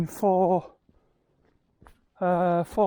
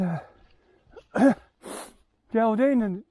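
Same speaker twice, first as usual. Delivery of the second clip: ordinary talk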